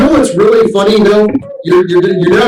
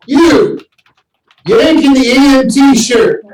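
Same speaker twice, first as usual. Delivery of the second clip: mock Aussie call; 6 semitones higher than usual. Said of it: "he says".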